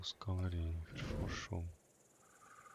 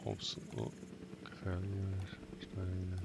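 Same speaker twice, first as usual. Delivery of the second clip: slow breathy whisper; 3 dB quieter, slower than usual. Real, not acted. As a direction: whisper it like a warning. Reads game item warily.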